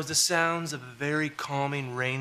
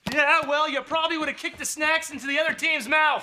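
irritated retort; 12 semitones higher, 4 dB louder than usual. Acted. Coach bickers back at player.

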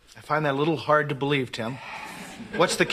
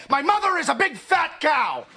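high, slow, indignant outburst; goading, offended, drawn-out delivery; bitter mock insult; 9 semitones higher than usual. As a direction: spell out the insult for him. Goading him to insult mother.

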